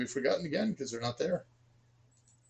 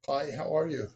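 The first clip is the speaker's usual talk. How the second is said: normal talking voice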